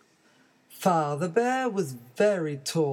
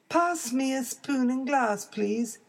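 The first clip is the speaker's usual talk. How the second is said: put-on baby bear voice; 8 semitones higher than usual.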